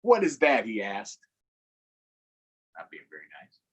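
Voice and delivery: put-on lively read-aloud for young kids; story read to children. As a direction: read the picture book with animated voices. Reading picture book aloud to children.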